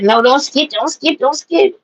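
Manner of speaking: mild mock whining protest; hissy fit. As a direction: imitate a fussy protest, rising in pitch. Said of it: "she having a hissy fit".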